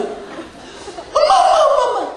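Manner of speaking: high, breathless little-ant squeal; hammed-up child frantic for mom; frantic calling. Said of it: "얘가 팔팔 뛰고 난리가". (엄마를 다급하고 높게 부르는 개미 흉내)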